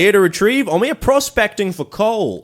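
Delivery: hammed-up high English gent; English accent, loud and high. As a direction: put on a silly posh English accent. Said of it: "put on an English accent".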